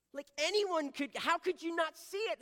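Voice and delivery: high, very loud incredulous outburst; hammed-up exasperation, voice shooting up; imitating upset wife. Wife scolding husband who can't see it, mock outrage. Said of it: "She was so upset with me".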